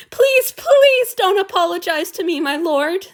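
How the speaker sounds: high, loud wailing please. A narrator's reading. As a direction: wail the plea at him. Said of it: "the man wailed".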